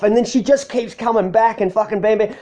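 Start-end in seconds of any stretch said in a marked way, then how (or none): none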